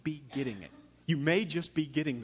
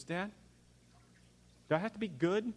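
put-on worried little child; 6 semitones higher than usual. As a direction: imitate a somber kid asking dad timidly. Somber child asks dad.

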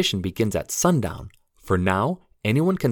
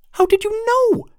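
pixie; 6 dB louder than usual. Acted. Pixie Lucy squeaks reply.

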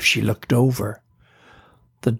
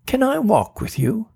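put-on hopeful girl's voice; 11 semitones higher than usual. A narrator's reading. Tina asks Sarah.

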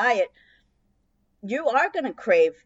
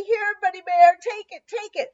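much higher, coaxing plea; pleading with bear; 10 semitones higher, 6 dB louder than usual. Pretend. Homeowner coaxing the hungry bear to take the food, high and pleading. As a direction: coax the sad bear, sweetly and high. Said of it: "out to him and say".

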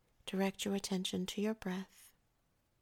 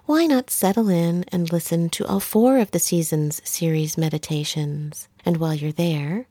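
much louder website plug; promotional read; 2 semitones lower, 15 dB louder than usual. Acted. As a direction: project it like a closing promo announcement.

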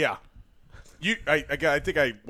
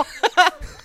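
high burst of laughter; real high laugh; 11 semitones higher than usual. Real, not acted. Laughing at the teasing.

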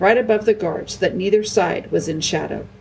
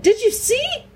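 very high, eager question; excited cry. A narrator's reading. Edmund asking if his brother saw, excited cry. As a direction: ask it breaking with excitement, high. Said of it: "cried Edmund".

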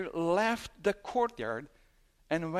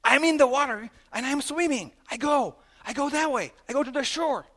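loud, high preaching voice, full force; 9 semitones higher, 9 dB louder than usual. Acted.

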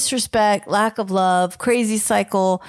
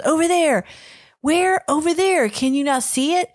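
higher, impatient "Over there" and "Where?"; mock exasperated back-and-forth; 7 semitones higher than usual. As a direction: play both sides of the argument, a little exasperated.

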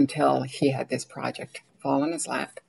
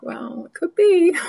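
high, teasing comeback; playful retort; 7 dB louder than usual. Retold or imitated. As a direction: toss it back lightly, high and loud. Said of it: "I'm like".

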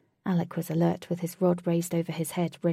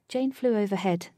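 plain reading voice

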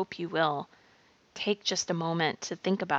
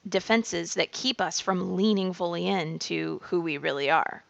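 usual speaking voice

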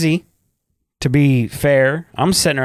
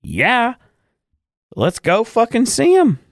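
much higher, eager mock wife voice; imitating wife; 11 semitones higher than usual. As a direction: play the wife, pitched way up and keen. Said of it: "She's like".

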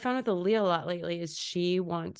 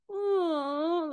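high, drawn-out pretend whimper; mock-sad, pitched-up puppy moan; 10 semitones higher than usual. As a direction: play a sad little puppy. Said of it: "like, I'm a sad puppy".

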